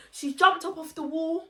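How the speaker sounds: normal talking voice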